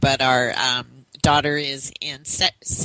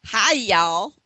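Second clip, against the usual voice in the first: put-on Southern accent; 6 semitones higher than usual. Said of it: "Shauna didn't know what a Southern accent was".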